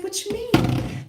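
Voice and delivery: high, slow, puzzled question; a high, drawn-out what; baffled. Retold or imitated. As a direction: sound genuinely thrown by it. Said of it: "And I was like".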